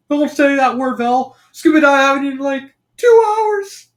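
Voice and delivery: high, loud cartoon whine; whining Shaggy. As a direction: complain like a starving cartoon slacker. Acted Shaggy complaining of hunger.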